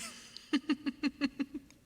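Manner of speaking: long, high, loud giggle; giggling. Real, not acted. Streamer joking with chat about thighs, giggling. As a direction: burst out in a run of giggles.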